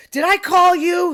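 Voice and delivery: high, slow, indignant mimicked shout; imitated angry shout. Mimicking the man who screamed at her, imitated shouting. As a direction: shout it like an irate customer. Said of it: "Do I get screamed at every day?".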